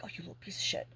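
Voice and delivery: whispered exasperated curse. Retold or imitated. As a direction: mutter it under the breath at him. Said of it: "And I'm like".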